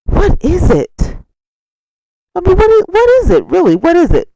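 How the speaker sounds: high, loud, baffled asking; incredulous. Real, not acted. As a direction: demand it in disbelief. Incredulous repeated question.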